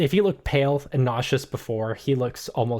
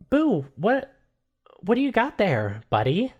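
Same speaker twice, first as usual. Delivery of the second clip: curious Rose; 8 semitones higher than usual. Acted. Rose questions Boo.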